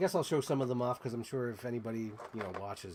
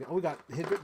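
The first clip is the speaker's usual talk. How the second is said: ordinary talk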